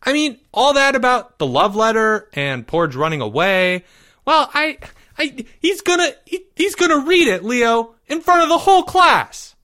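put-on high, urgent, stumbling kid voice; panicky young boy. A narrator's reading. Boy warning friend of public shame, high and panicky. Timmy panics about letter.

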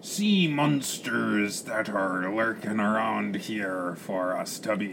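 slow, rumbling pirate drawl; pirate captain. Acted. Captain worries about sea monsters, pirate drawl. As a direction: draw out the vowels like an old sea dog.

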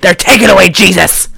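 loud mock-paranoid tirade; lunatic conspiracy theorist. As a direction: fire off conspiracies like a ranting madman. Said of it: "that's exactly how he sounded".

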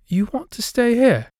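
put-on high ghost girl voice.

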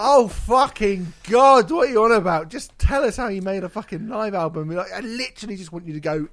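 loud, high, fed-up rant; exasperated outburst. Retold or imitated. Exasperated inner reply to rambling star.

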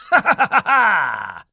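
loud, high pirate cackle; cackling laugh. A narrator's reading. Old Jim takes the lead round the island, cackling. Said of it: "letting out a cackling laugh".

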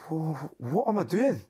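high, drawn-out bewildered question; mock-alarmed, stretched-out self-questioning; acted panic. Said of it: "I'm, like, panicking".

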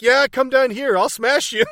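high, loud retold taunt; hammed-up shouted challenge; mock tough threat. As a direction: call him out with big empty bravado. Acting out beaten man's empty threat.

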